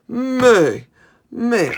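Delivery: loud, high, drawn-out meh; goat bleat. Pretend. Answering what a goat says, loud high bleating. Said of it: "What does a goat say?".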